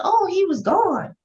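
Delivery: high, slow dopey voice; dumb high voice. Retold or imitated. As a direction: play him as a clueless kid. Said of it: "Kanan dumbass said".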